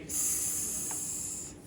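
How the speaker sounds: long sustained "s" hiss; isolated S sound. Shown as a demonstration. Teacher asking students which letter says it, isolated S sound. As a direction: hold "s" as a pure sound, no vowel. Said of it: "What can say s".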